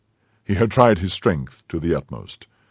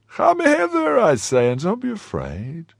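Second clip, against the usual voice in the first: coaxing call. Acted. Athos coaxing fearful host.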